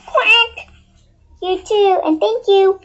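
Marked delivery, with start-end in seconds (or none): 0.1-0.7 duck quack. Acted. Duck says goodbye.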